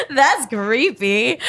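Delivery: laughs through it, up high; laughing at a creepy thought. Real, not acted. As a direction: giggle the words out.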